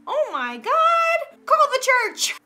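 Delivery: high, loud, slow-drawn mock alarm; mock horror. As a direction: cry out in scandalized panic, hammed up.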